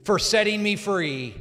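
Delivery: slow, loud prayer, line by line; loud and strong. Real, not acted. Pastor leads new believers in a prayer, loud and strong. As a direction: proclaim each phrase for the room to echo. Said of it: "loud and strong".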